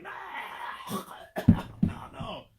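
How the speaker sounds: gagging coughs between breathy noes; choking on the cure. Acted. Lizard forced to swallow the cure, choking and coughing. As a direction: splutter as it goes down. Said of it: "He's like".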